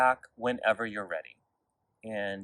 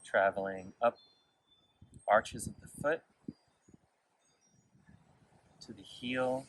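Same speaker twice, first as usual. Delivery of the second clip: guided meditation; 4 dB quieter than usual. Real, not acted. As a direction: lead gently through the body, unhurried and quiet. Leads a body scan meditation.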